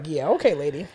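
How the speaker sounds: low, quiet deadpan; mock unimpressed brush-off; sarcastic dismissal. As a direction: shrug her off flatly. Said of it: "we're just like".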